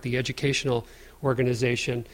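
ordinary talk, nothing marked

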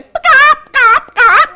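high loud pop pop pop; calling out. Acted. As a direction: call the friends in. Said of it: "she came".

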